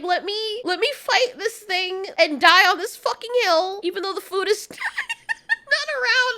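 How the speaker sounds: high, heated imitation of a stubborn dog; mock-defiant dog. Reviewer mocks dog picking a doomed fight, high and exasperated. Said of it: "this dog is like".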